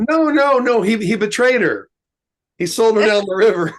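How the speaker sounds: high, insistent correction; a hint of an impatient wife; exasperated wife. Wife correcting him, retold.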